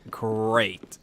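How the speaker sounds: soft, low, deadpan "Great"; sarcastic great. Retold or imitated. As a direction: say "Great" flat and dry, plainly lying. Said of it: "And I'm like".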